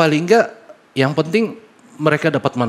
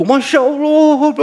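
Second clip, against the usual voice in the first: drawn-out, high, louder cry of "Masya Allah"; retold cry of awe; 11 semitones higher, 6 dB louder, much slower than usual. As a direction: stretch it out high and loud, overwhelmed. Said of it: "Bapaknya teriak".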